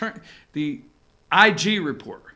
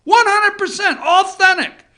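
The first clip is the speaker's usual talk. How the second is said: mock candidate voice; 11 semitones higher, 6 dB louder than usual. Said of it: "This guy's saying".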